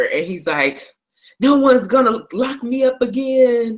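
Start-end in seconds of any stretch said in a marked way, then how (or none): 1.4-3.8 defiant captive. Retold or imitated. Character defiantly refusing lockup.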